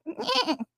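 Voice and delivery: short high burst of laughter. Real, not acted. Streamer laughing at a chat joke.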